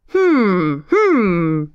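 two long falling hums; thoughtful royal humming; pondering hums. A narrator's reading. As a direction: hum slowly, weighing the question. King ponders before consulting book.

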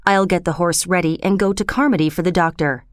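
plain reading voice

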